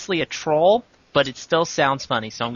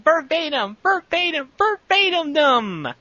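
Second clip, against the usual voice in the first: mock shouting; 11 semitones higher than usual. Pete fleeing home, high mock shout. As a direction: cry it out like a silly chant.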